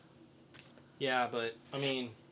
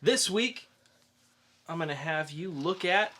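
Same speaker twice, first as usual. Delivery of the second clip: ordinary talk, nothing marked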